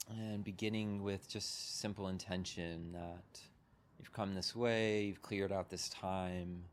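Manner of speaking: unhurried meditation guide, long pauses between phrases; guided meditation. Real, not acted. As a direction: guide them gently into settling, leaving room to breathe.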